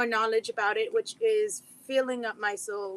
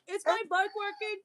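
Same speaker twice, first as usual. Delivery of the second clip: high, playful dog voice; voicing the dog; 8 semitones higher than usual. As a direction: speak as the dog wondering if he's heard. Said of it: "he's like".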